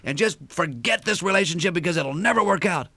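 mock wife yelling him away; shouting through door. Wife shouting through locked door, hammed-up high yell. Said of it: "she shouts through the door".

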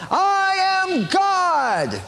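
high, slow drawn-out proclamation; mock Shirley MacLaine. Preacher mocking Shirley MacLaine on the seashore, high and drawn out. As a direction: ham up her grand declaration. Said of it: "and she's saying".

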